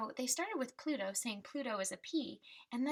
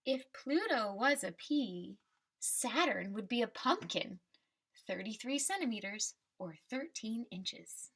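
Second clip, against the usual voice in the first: put-on reading to children; 3 semitones higher than usual. Teacher reading the class a picture book, storybook voice. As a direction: read the refrain like a picture book for kids.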